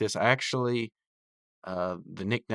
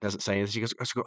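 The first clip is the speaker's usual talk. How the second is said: normal talking voice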